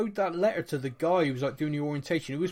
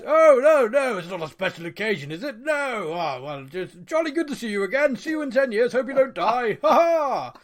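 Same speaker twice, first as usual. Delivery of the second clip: loud, high hammed-up English toff accent with laughs; over-the-top mock British accent, laughing; mock posh British; 6 semitones higher, 6 dB louder than usual. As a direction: overplay a jolly English relative, shouting cheerfully. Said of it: "the Brits are like".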